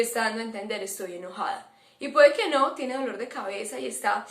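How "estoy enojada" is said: low, much softer mock grumble; voicing an annoyed mom. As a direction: mutter it like a mom with a frown. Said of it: "con el ceño fruncido". (murmullo de mamá enojada, suave)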